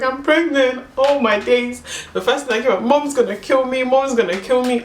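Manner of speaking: high-pitched retold wail; mock tearful panic. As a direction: act out panicking between laughing and crying. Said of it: "I started crying, and I was laughing".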